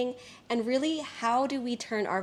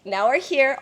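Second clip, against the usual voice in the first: excited; 4 semitones higher, 7 dB louder than usual. Real, not acted. Laughing at the contrast.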